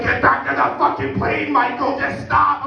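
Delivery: high-pitched mock wife scolding; wife's yelling. Wife ordering Michael back on the plane, high mock scolding. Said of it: "The wife's just like".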